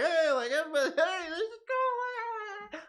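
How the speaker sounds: rally everyone like a cheerleader gone overboard; over-the-top rallying cry; full turbo hype. Acted. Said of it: "did I just go full turbo".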